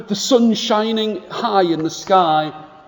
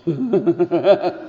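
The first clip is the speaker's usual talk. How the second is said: giggle. Shown as a demonstration. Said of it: "Can you do it?".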